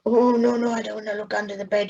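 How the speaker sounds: put-on quick, scared child's protest; frightened refusal. A narrator's reading. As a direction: blurt it out in a panic. Boy refuses to look under bed.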